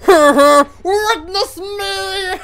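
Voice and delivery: high, slow ecstatic scream; overacted war cry. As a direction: cry it out like a zealot. Mad Max war cry.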